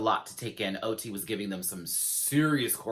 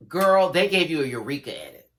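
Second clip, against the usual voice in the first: loud, sassy callout; excited sass; 6 semitones higher, 8 dB louder than usual. Real, not acted. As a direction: call her out with glee. Addressing queen directly.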